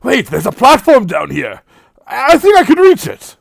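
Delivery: high, loud excited shout. Acted. Man shouts from the edge to the party, high and loud. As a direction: call up from the edge. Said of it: "You hear him shout out".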